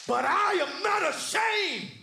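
high, loud pulpit shout; full-voiced preacher's climax; defiant shout. Sermon climax, defiant declaration.

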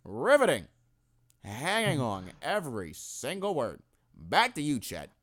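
slow, deadpan announcer voice; sarcastically unimpressed announcer; mock announcer voice.

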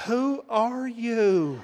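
hammed-up astonished wonder; mock awe. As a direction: stretch each word in amazement. Mock awe at wife after upgrade.

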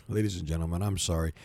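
ordinary talk

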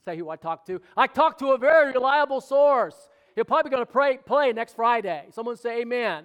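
loud, high, driving sermon delivery; full-force preaching. Acted. Pastor rallying the congregation, preaching at full force.

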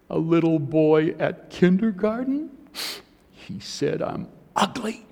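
put-on a small child's voice, high and hurt, the last words breathed almost voiceless; tearful little girl. Daughter tells her father why she cries, a hushed tearful child. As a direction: play his young daughter telling him through tears.

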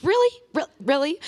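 high-pitched incredulous questioning; mock disbelief. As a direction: react in exaggerated disbelief, pitch leaping up. Retold disbelief at husband's gagging.

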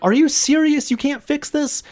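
high, loud disbelieving mock outrage; hammed-up Karen disbelief; annoyed outburst. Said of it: "the mom just got really annoyed".

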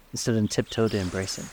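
usual speaking voice

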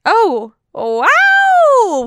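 loud high-pitched shock; surprised. Real, not acted. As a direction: gasp it out in disbelief. Shocked at thirty minutes.

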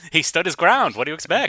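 sarcastic. Pretend. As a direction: sneer it like a gun-rights blowhard. Mock gun owner defence.

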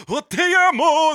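high, loud, hammed-up Spanish exclamation; mock Spanish singer. Podcast host mocking a singer's put-on Spanish, high and loud. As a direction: gush it like a fake Spanish crooner. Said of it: "'cause they're like".

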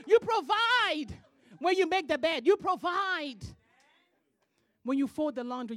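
loud, high preacher's delivery; loud sermon cadence, hammering each line; full-force preaching. Acted. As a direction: preach it hard to the congregation.

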